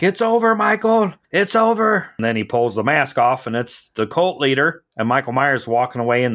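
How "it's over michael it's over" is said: much higher, louder dramatic declaration; imitating Loomis. Loomis telling Michael it's finished, high and hammed-up. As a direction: proclaim it like a hammy hero at the climax. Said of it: "he's like".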